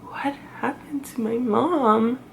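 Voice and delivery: whispered, slow and puzzled; kids whispering. Retold or imitated. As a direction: breathe it like a confused child. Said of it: "my kids are just looking at me like".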